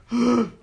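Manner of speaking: loud, very high imitated gasp; mock shocked gasp; crowd gasp. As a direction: mimic a crowd gasping. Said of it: "all this gas going".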